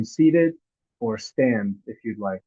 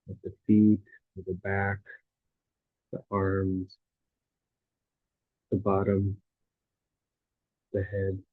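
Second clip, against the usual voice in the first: guided meditation; 2 semitones lower than usual. Real, not acted. Teacher guiding listeners through a body scan, calm meditative delivery.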